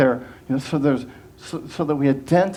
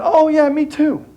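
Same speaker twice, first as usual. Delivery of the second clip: high-pitched hammed-up me-too; gushing oversharer; 8 semitones higher than usual. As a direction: cut in eagerly and make it all about you. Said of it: "she said".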